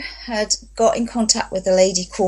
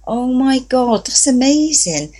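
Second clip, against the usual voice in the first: amazed thought; 6 semitones higher, 3 dB louder than usual. Retold or imitated. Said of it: "I thought".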